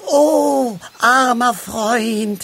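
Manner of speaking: high, loud, drawn-out lament; wailing. A narrator's reading. Halbschuh mourns over the piece of muff, wailing. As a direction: wail slowly over a lost friend. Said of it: "jammerte Halbschuh".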